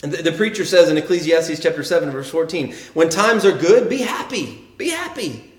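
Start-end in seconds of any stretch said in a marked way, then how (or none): 3.0-5.5 high, lilting encouragement; upbeat exhortation. Acted. Preacher quoting scripture to the congregation, upbeat exhortation. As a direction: urge it brightly, pitch climbing on the repeat.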